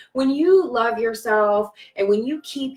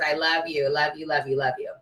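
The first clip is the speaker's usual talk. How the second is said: tender love you; 5 semitones lower, 5 dB quieter than usual. Real, not acted. Fading loving repetition to viewers.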